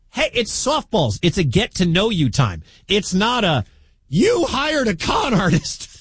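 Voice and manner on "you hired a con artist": high, drawn-out mock indignation; incredulous mock challenge; mock outraged accusation. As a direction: throw a slow, high-pitched accusation, hammed-up. Mimics a hostile question against softballs.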